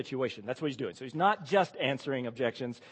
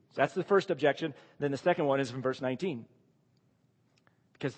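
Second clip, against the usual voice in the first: ordinary delivery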